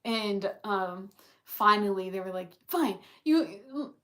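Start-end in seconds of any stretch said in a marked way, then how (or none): 2.7-3.9 flustered loud confession. Retold or imitated. Jamie blurting out the confession.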